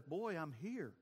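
soft, understated answer from God; hushed, unbothered voice of God; God's laid-back reply. Acted. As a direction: answer a shouter quietly and casually. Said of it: "And the God says".

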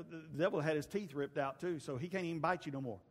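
ordinary talk, nothing marked

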